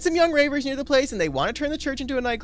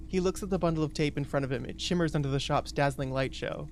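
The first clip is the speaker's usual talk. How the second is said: low, soft narrator voice; story narration; 7 semitones lower, 6 dB quieter than usual. A narrator's reading. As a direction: read it softly, pitched well down. Reads game narration aloud.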